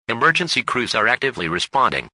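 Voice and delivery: ordinary talk, nothing marked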